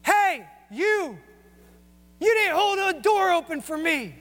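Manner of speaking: high, sharp mock yell at a stranger; woman yelling at him in a library, high retold yell; retold angry yell. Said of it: "I hear this lady yell".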